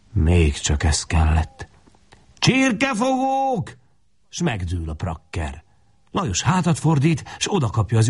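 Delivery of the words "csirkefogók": very high, long-drawn cry; outraged shriek. A narrator's reading. Woman attacking the boys with a beater, outraged shriek. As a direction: stretch the insult out at soaring pitch.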